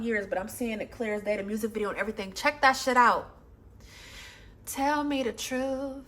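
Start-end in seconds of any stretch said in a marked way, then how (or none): 4.7-6.0 slow and soft, like a quiet ache; soft heartbroken plea. Acted. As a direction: ask it slowly, barely above a breath of sound. Recites song's heartbroken words.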